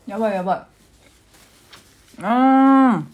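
slow panicked groan into a loud wail; real dismay over a spill. Real, not acted. Spilling ponzu sauce. (慌てた悲鳴)

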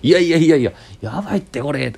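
teasing, overexcited reaction; excited protest. Retold or imitated. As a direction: pile on the disbelief. Colleagues reacting to the blunder.

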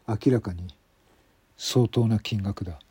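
ordinary delivery

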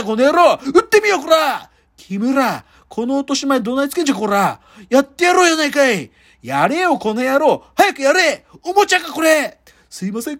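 rough Kansai yakuza threats, barked; menacing gangster quarrel; yakuza movie voice. Acted. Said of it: "ヤクザの映画って".

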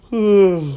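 loud sleepy yawn; big open-mouthed yawn, no words. Real, not acted. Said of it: "あくび出てしまいました".

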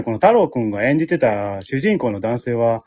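ordinary talk, nothing marked

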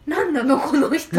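loud, high, laughing disbelief; shocked outburst. Retold or imitated. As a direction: blurt it out, half laughing. Child reacting to scary character.

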